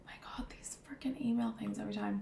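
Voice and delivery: whispered grumble, barely voiced; exasperated aside. Real, not acted. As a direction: grumble under your breath at an interruption. Exasperated aside about interrupting emails.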